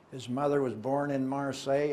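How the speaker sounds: ordinary talk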